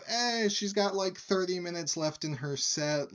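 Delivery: high-pitched offhand bartender patter; imitated bartender. Bartender tells the bar about the singer's set, imitated. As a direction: lift the voice as the bartender explaining himself. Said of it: "the bartender continues".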